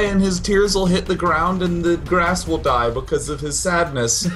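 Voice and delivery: high, slowed mock-epic boast; mocking boastful player. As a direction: ham up a dramatic villain boast. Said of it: "have him be like".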